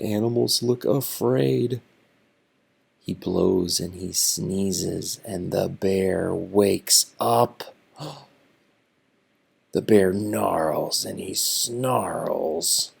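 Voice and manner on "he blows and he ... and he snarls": growly bear reading. A narrator's reading. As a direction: grow into a grumpy bear. Reading bear's rising temper.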